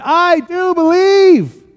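loud, high, drawn-out cry of faith; exclaimed belief. Acted. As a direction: cry it out with all your might. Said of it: "the boy's father exclaimed".

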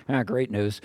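low, soft, unimpressed aside; deadpan sarcasm. Retold or imitated. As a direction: drop pitch and volume for mock gratitude. Sarcastic retold reaction to gloomy radio.

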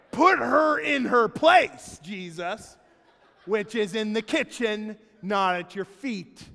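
high, loud whiny demand to Jesus; hammed-up tattling sister, slowly; mock indignant plea. Voicing Martha's demand for justice.

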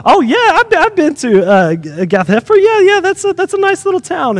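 high, chatty acknowledgement; mock small talk. Sailor chats about Jonah's town before they die, mock friendly. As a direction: imitate someone politely keen on a stranger's hometown.

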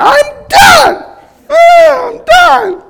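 very high, loud whining wail; toddler meltdown. Retold or imitated. Father imitating his daughter's second meltdown, high loud wail. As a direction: howl it like an overtired two-year-old. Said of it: "within a few minutes".

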